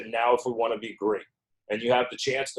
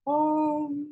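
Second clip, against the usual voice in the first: high floaty angel voice on the um; 3 dB quieter than usual. Pretend. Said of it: "that was my angel voice".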